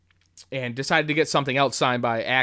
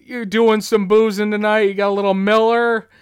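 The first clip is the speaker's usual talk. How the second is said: high, loud, chummy questioning; imitated jovial party buddy; Tom Atkins impression; 7 semitones higher, 6 dB louder than usual. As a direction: rope them into drinks with gusto. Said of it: "inflection is so spot on for him".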